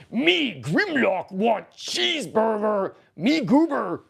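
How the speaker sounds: broken-grammar brute demanding food, stretched out; Grimlock. Acted. Streamer plays a dinosaur robot wanting cheeseburgers, hammy brute voice. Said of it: "instead of Grimlock".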